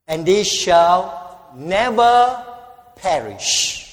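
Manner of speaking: loud, slow pulpit reading of scripture; booming scripture reading, slow and loud; preaching scripture. Acted. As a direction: proclaim every word to the congregation. Reads scripture at full force.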